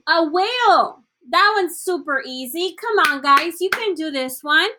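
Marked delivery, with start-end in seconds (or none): none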